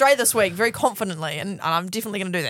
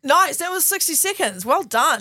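excited; 7 semitones higher, 3 dB louder, slower than usual. Real, not acted. Cheering after review.

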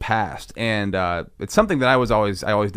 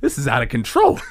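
incredulous onlooker, louder and higher; hammed-up disbelief with raised pitch; mock astonishment; 4 semitones higher, 4 dB louder than usual. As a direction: act out a stunned crowd, stretching the words. Acting out stunned onlookers' reactions.

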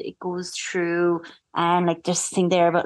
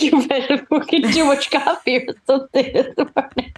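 laughing mock quote; 7 semitones higher than usual. Teasing co-host, laughing through quote.

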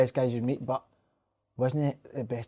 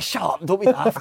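a raised, louder mock brush-off; dismissive retort; 6 semitones higher, 5 dB louder, slower than usual. Teammate on the bench, mimicked brushing him off with a raised voice. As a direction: scoff at him like a teammate waving it away. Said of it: "He's like".

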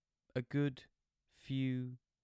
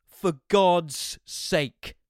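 loud, very slow exasperated shout; 6 semitones higher, 11 dB louder, much slower than usual. A narrator's reading. Son exploding at his parents, loud and slow. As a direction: bellow it in frustration. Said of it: "I shouted".

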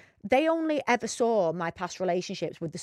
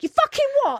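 high, loud outraged yelp; shocked shout; 6 dB louder than usual. Retold or imitated. Retelling to her co-host, a shocked high shout. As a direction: shriek it in mock disbelief. Drunk outrage at stripclub tour guide.

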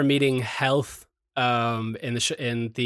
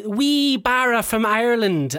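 slow, higher-pitched stage-Irish imitation; hammed-up Irish greeting, higher voice; mock Irish accent; 7 semitones higher, slower than usual. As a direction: mimic a patronising Irish accent, drawn out. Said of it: "like, the accent and the chi".

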